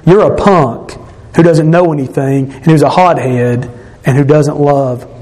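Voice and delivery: usual speaking voice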